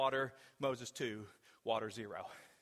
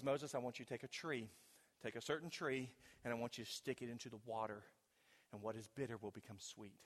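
softer voice of God; 3 semitones lower, 9 dB quieter than usual. Acted. God speaks to Moses.

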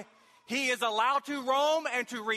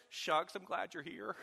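mock mamby-pamby Jesus; 4 semitones lower, 8 dB quieter than usual. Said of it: "they just picture Jesus some mamby-pamby".

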